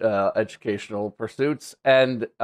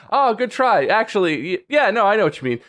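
high, indulgent mock reassurance; encouraging local; 8 semitones higher than usual. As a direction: imitate a kindly local humoring a beginner. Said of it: "expect people to be like".

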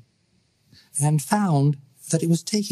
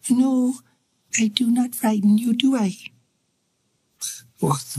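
put-on eerie coquettish child; 7 semitones higher than usual. Ghost child speaks to Lowry.

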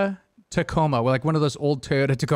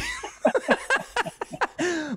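high laugh; 5 dB quieter than usual. Real, not acted. After escalated quickly.

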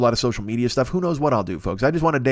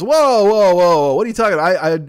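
alarmed whoa; 6 semitones higher, 7 dB louder than usual. Retold or imitated. Stingy client voiced in story.

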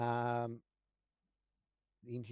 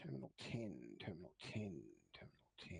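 fading mutter into a whisper; muttering to self; 8 semitones lower, 10 dB quieter than usual. Real, not acted. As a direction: mumble it while looking something up. GM muttering while searching map.

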